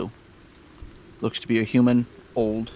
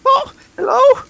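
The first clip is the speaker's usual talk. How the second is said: very high, odd little creature; squeaky creature voice; 8 dB louder than usual. Acted. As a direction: squeak every line way up high. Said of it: "Sounds like a cat".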